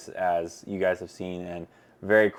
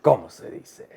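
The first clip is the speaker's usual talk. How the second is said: 1 put-on Spanish accent; 4 dB louder than usual. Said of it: "sounds better when I talk like that".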